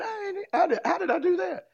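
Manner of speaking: high, laughing astonishment; giddy how-did-I-do-that; amazed disbelief. Retold or imitated. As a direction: marvel at your own change. Amazed at himself.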